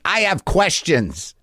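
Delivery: slow, drawn-out I have questions; slow, pointed reply; slow deliberate answer. Shown as a demonstration. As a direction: spell it out slowly, each word weighted. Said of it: "and you're like".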